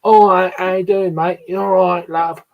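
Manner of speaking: matey, common East London-Essex greeting; common working man's accent. Shown as a demonstration. Showing how locals talk, common working man's accent. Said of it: "common accent. And it's like".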